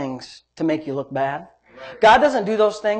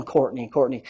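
inner thought; 8 semitones lower, 7 dB quieter than usual. Retold or imitated. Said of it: "I'm thinking".

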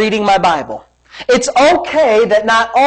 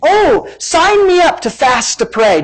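high, drawn-out fake enthusiasm; slow, put-on keenness; mock eagerness; 6 semitones higher, slower than usual. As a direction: pretend to be thrilled to volunteer. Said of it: "And your body says".

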